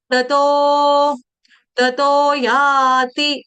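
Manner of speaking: ततो stretched very slowly, single त; drawn out tato. Shown as a demonstration. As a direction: pronounce ततो slowly and cleanly. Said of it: "इसको ततो नहीं पढ़ना है". (ततो, धीरे और साफ)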